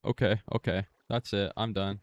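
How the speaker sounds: real panic; loud flustered panic. Real, not acted. Gamer near death in the fight, loud panic.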